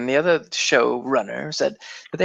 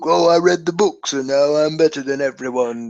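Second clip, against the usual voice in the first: pretentious book reader; 3 semitones higher than usual. Acted. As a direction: imitate a smug book snob. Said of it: "pretentious book reader".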